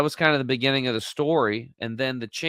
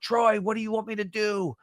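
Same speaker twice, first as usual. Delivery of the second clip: retold yell; 10 semitones higher than usual. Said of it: "I yelled out".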